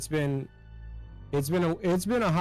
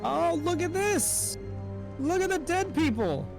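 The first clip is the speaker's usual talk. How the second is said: excited; 13 semitones higher than usual. Real, not acted. Excited at dead pirates.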